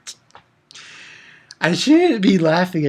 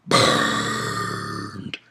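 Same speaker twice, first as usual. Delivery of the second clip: whispered taunt; 6 dB quieter than usual. Real, not acted. Echoed taunt.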